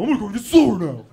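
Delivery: spiteful driver. Retold or imitated. Comic voicing a petty driver in the rearview, snide taunt.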